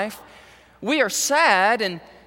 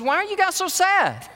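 gently teasing Jesus; 11 semitones higher than usual. Retold or imitated. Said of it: "Jesus says".

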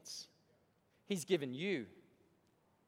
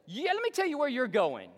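loud pitchman teaser; radio ad voice; 7 semitones higher, 7 dB louder than usual. Retold or imitated. Preacher mimicking the radio commercial, loud teasing pitchman. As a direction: tease it like an announcer. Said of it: "He goes".

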